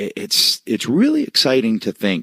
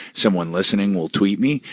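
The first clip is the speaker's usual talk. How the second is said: normal talking voice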